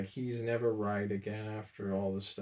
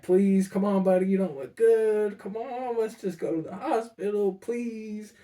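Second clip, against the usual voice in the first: much higher, louder, voice straining with emotion; desperate pleading; 11 semitones higher, 9 dB louder than usual. Retold or imitated. Grandson begging dying grandpa to go, voice breaking. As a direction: beg him as your voice cracks. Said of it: "pissed and emotional".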